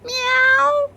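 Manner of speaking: high loud cat meow; a defiant little meow. A narrator's reading. The cat answers the boy with a meow, then runs. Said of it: "But Stevenson said".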